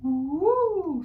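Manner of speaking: soft, high sung woo. Shown as a demonstration. Teacher models Baby Owl's soft high voice. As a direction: float a soft high note. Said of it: "using that same soft voice".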